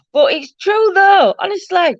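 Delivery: words squeezed out through a high laugh; giggly, breathless agreement. Real, not acted. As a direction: still laughing as she agrees. Laughing reply to a chat comment.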